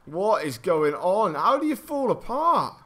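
drawn-out, incredulous wail climbing high; real bewildered exasperation; exasperated disbelief. Real, not acted. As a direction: stretch the questions out, voice rising in despair. Despairing at falling apart in game.

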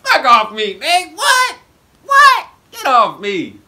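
high, loud disbelieving yells; excited outburst. Real, not acted. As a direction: shout it out in delighted disbelief. Host reacts to wild story.

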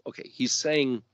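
normal talking voice

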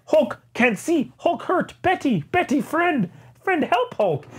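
high, slow, whimpering Hulk voice; a wounded brute pleading in a high whine; pitiful Hulk. Retold or imitated. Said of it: "And he's like".

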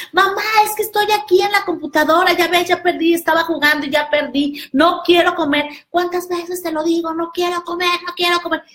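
put-on high, fast whiny kid; bratty daughter. Daughter whines over losing her game, high bratty voice. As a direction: complain like a spoiled gamer girl.

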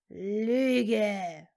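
snake-like angry hiss. A narrator's reading. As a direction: spit the word as a hiss. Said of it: "zischte der Mann".

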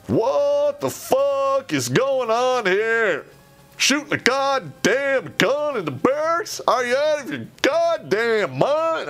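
retold fury, pitched high and drawn out; mock outraged yell. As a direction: bellow it like a sergeant catching them red-handed. Catching rangers shooting in barracks.

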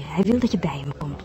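put-on wife whispering to her husband about the old man; whispered aside. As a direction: whisper it so he can't hear.

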